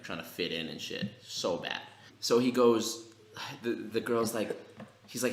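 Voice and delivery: ordinary talk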